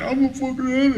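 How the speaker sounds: high, whimpering retold sob; mock crying. Addict retelling his early clean days, mock crying. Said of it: "I started crying".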